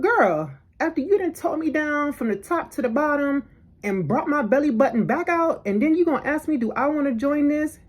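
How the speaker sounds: high, sassy telling-off; worked-up, high-pitched indignation; indignant retort. Retold or imitated. Retelling her indignant inner retort.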